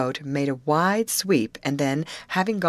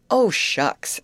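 raised, louder dismissive exclamation; quick exclamation; 5 semitones higher, 5 dB louder than usual. A narrator's reading. Steersman brushing off Betty's offer of pay, quick exclamation. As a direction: wave it off briskly. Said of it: "exclaimed the steersman quickly".

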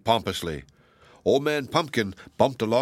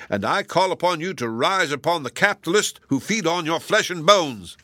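higher, louder soapbox ranting; rabble-rousing scarecrow; 6 semitones higher, 3 dB louder than usual. A narrator's reading. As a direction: whip up the crowd against the capitalists. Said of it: "he was saying".

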